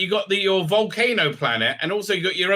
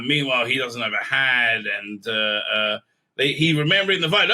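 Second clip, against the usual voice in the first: normal talking voice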